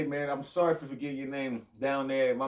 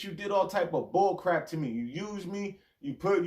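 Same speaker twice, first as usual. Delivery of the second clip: ordinary delivery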